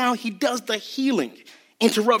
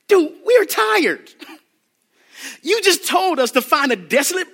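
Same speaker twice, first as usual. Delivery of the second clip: complaining disciples; 6 semitones higher than usual. Acted. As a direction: play the worn-out disciple griping at Jesus. Preacher voicing tired disciples.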